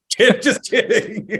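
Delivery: laughing through the words; real laugh spilling into the words. Real, not acted. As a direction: take back the joke while laughing. Laughs after joking insult.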